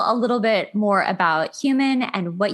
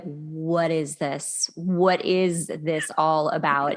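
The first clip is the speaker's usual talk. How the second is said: bewildered friend; 5 semitones lower, much slower than usual. Retold or imitated. Host imitating her divorced girlfriend, slow and baffled. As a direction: drag it out like a lost divorcee. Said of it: "And she's like".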